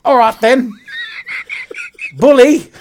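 a cheeky British bloke; mock British accent. Host vows to keep the mock British accent for the whole show. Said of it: "I'm gonna do this the entire show".

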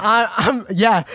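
ordinary delivery

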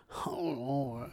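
very soft, low mumbled hesitation; contemplating, reluctant. Retold or imitated. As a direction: imitate someone barely willing to answer. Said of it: "He was just contemplating, like".

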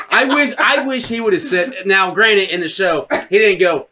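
normal talking voice

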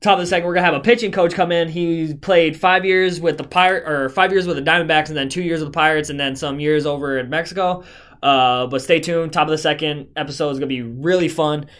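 loud, upbeat podcast host sign-off; show host outro. Acted. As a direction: announce the next episode like a host. Host previews next guest.

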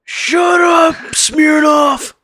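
high hammed-up shout at the scientist; mock yell. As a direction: yell it like an annoyed cop. Retelling Hopper yelling at Alexei.

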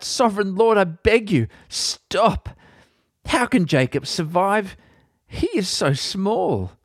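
plead, stop the judgment; desperate plea. Acted. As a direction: Amos begging the Lord to stop, desperate. Amos pleading with God again.

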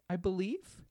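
ordinary talk

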